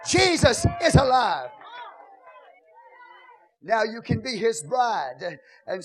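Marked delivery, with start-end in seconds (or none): 0.0-1.5 loud, ringing preacher's cry over the music; full-force preaching. Acted. Preacher urging the church to speak to their souls, loud and fervent. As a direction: whoop it up like a preacher stirring the house.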